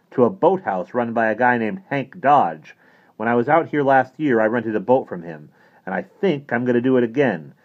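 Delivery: theatrical, energetic announcement; animated priest character. Acted. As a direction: lay out the plan with brisk authority. Voices the priest explaining plan.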